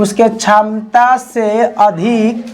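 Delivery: slow, spaced-out dictation voice; teacher dictating notes word by word. Acted. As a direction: read each phrase slowly for the class to write. Dictating a definition to class.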